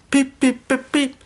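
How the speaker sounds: high piped beep beep; imitated whistle. A narrator's reading. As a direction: pipe it like a train whistle.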